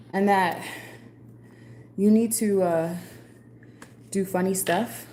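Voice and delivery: loud, high and breathless; energetic after a run, voice up; excited. Real, not acted. Returns after running, excited.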